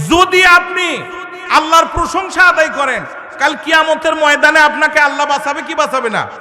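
high, urgent sermon voice; full-force preaching. Acted. Preacher returns to fiery delivery.

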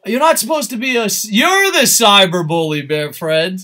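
shouted playful accusation; loud mock scolding. Real, not acted. Streamer scolding a friend in chat, loud mock outrage. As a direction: call them out at full volume.

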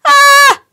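high piercing scream. A narrator's reading. Mike knocked down by a dog, piercing scream. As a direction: scream as you get knocked down. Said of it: "Mike screamed".

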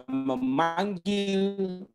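normal talking voice

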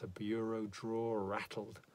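plain reading voice

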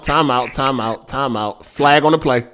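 calming referee. Real, not acted. Host calls time out on arguing co-hosts, calming.

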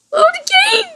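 high startled scream breaking into a wail; frightened shriek. Real, not acted. Player recoils from the horror game, frightened shriek.

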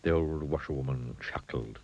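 ordinary delivery

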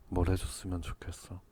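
ordinary delivery

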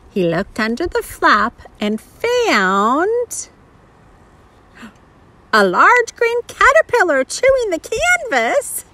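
put-on animated reading to a small child, words stretched; picture-book narration. A narrator's reading. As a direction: read it with exaggerated, swooping intonation.

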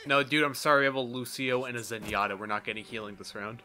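ordinary talk, nothing marked

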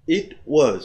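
ordinary talk

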